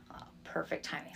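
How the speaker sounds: soft breathy whisper; whispered aside. Real, not acted. Noting to the host the timing was perfect, whispered. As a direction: murmur it like a sly secret. Quiet aside while retelling.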